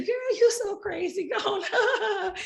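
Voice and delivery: high, giggly fake cheer; fake laughing brush-off. Bride brushing off her friend's offer, high fake laughing.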